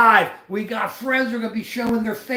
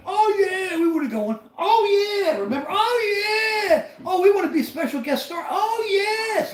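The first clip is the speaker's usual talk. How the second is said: much higher, whiny begging friends pleading to get on air; mocking eager friends; 13 semitones higher than usual. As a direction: overeager buddies begging, pitched way up and half shouted. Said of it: "You hear 'em all in the back?".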